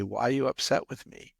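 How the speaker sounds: ordinary delivery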